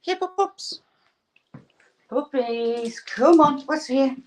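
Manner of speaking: high, coaxing puppy-talk voice; calling puppies. Real, not acted. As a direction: coax the pups over in a sing-song pitch.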